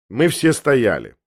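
ordinary delivery, nothing marked

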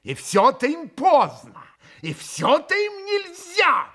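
exasperated, loud, climbing to a near squeal; почти взвизгнула. A narrator's reading. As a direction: complain with rising vexation. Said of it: "почти взвизгнула в досаде Грушенька". (досадливо, почти визгом)

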